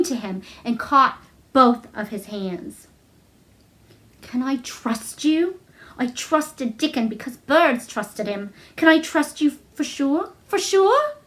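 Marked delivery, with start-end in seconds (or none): 4.2-11.1 imploring. Acted. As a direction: beg him to promise. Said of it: "she implored".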